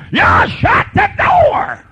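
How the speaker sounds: slow, high, shouted command; angry yell. Shown as a demonstration. Parent yelling at child to shut the door. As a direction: bark it like a fed-up parent. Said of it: "You don't have to talk like that".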